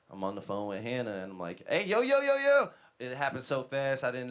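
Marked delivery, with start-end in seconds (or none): 1.7-2.7 rising high, loud warning shout; alarmed calling out. Retold or imitated. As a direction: call out urgently to stop a driver. Calling out to reversing car.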